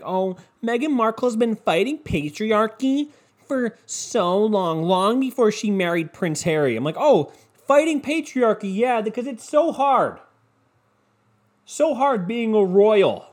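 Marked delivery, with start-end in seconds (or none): 0.0-6.8 higher-pitched, drawn-out mock gushing; mock admiration. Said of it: "Everyone's like". 7.0-13.3 high-pitched, exaggerated mock sympathy; sarcastic mock sympathy. Sarcastic acted reply to the praise.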